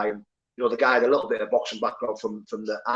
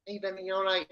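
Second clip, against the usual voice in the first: higher, squeaky mock of the little fighter; imitating a squeaky voice; 7 semitones higher, 10 dB quieter than usual. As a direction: mimic a tiny guy's squeaky greeting. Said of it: "has a dead squeaky voice".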